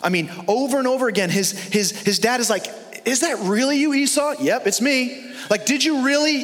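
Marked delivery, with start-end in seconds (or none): 3.0-4.4 high, questioning voice of the blind father; doubting old father. Retold or imitated. Acted father-son exchange. 4.4-5.3 high, breezy fake-casual reply; sneaky son. Acted father-son exchange. 5.7-6.4 high, questioning voice of the blind father; doubting old father. Retold or imitated. Acted father-son exchange.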